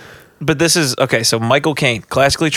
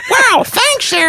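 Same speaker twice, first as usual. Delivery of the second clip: very high squeaky puppet thanks; crude puppet; much slower than usual. Acted. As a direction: chirp gratefully as a puppet. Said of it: "they're, like, so crude about it".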